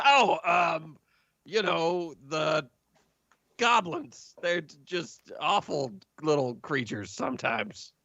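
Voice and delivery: high, panicky stammer at gunpoint; a frightened little man blurting out an answer; nervous patron. Acted. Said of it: "And he goes".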